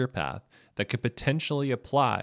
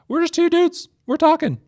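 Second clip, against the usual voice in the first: squeaky high voice; 8 dB louder than usual. Pretend. Jokey squeaky aside after disclaimer.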